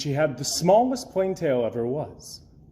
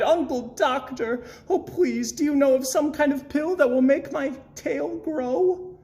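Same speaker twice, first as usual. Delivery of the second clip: high, begging little bird voice; pleading cry; 11 semitones higher than usual. A narrator's reading. Gertrude begging her uncle for a pill, high and pleading. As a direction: beg the uncle, voice pitched up. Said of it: "she cried".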